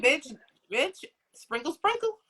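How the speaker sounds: high-pitched catchphrase impression; mock high voice with a laugh behind it; Shira Seven. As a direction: playfully mimic a sassy catchphrase, pitch climbing. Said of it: "Shira was like".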